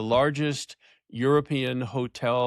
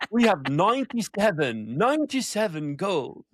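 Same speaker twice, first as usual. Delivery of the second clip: a hammed-up British accent, pitched higher and slower; mock posh British accent for a laugh; 5 semitones higher than usual. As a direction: play a distinguished British executive, badly on purpose. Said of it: "if you could do it with the British accent".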